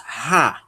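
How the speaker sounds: "ra" with a breathy h at the start; "ra" pronounced as an aspirated ha; strong aspiration. Shown as a demonstration. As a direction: puff air into the start of "ra". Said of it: "se fosse uma aspiração forte".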